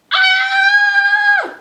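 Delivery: high, loud startled shriek; a sudden terrified cry; frightened scream. Acted. Monsieur Dupont seeing the lion, high shrieking cry.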